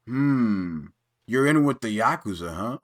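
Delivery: low Kazuya voice. Retold or imitated. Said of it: "and Kazuya's like".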